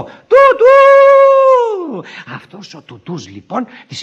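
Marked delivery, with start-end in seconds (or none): 0.0-2.0 very high, long-drawn loud hoot; hooted pet name. Acted. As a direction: toot it out like a train whistle. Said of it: "τώρα το έκανε χαϊδευτικό". (δυνατό, τσιριχτό σφύριγμα τρένου)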